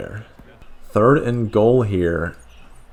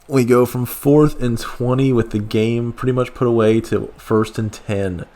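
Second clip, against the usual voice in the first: usual speaking voice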